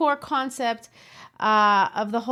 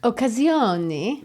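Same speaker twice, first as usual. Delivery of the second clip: Italian pronunciation, slow and rolled; Italian accent; slower than usual. Shown as a demonstration. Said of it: "how do you say that with your Italian accent".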